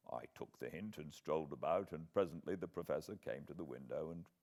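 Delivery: usual speaking voice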